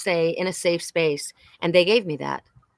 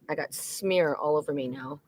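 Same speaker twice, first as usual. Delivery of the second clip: ordinary delivery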